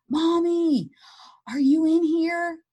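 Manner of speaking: put-on a little boy calling his mom; child's yell. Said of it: "And he yelled".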